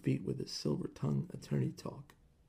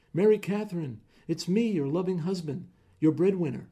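raised voice, calling in through the window; loud calling out; 5 semitones higher, 7 dB louder than usual. Acted. Husband calling through the window to his wife, loud. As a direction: announce yourself loudly to your wife.

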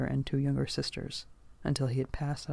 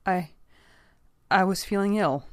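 hesitant, broken start, then higher; stuttered excuse; 5 semitones higher, 4 dB louder than usual. A narrator's reading. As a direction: falter and restart the excuse nervously. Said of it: "he stuttered".

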